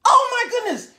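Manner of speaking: high, loud amazed exclaims; excited shouting. Real, not acted. Excited storyteller reacting.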